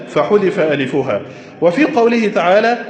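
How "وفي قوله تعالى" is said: usual speaking voice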